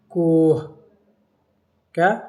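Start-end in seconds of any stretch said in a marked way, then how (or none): 0.1-0.6 کوہ with long o and an audible h at the end; sounded final h. Shown as a demonstration. Teacher listing examples for students, sounded final h. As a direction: let the ہ be heard closing کوہ. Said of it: "جو تلفظ میں آئے".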